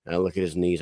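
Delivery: ordinary talk, nothing marked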